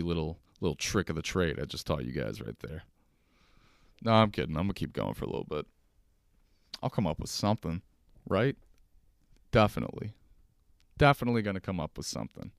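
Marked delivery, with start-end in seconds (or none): none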